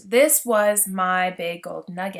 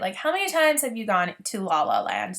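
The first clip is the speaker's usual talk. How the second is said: ordinary talk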